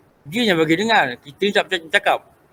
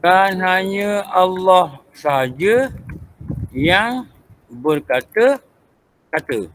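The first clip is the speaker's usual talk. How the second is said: every word drawn out for note-taking; slow dictation; much slower than usual. Acted. Teacher dictating a tauhid point to students, slow and deliberate. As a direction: dictate it slowly so the class can write.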